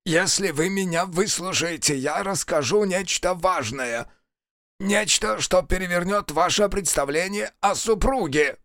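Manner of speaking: high-pitched, strained envoy voice; strangled envoy. A narrator's reading. Envoy pinned to the wall bargains, strained. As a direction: press it out high and insistent.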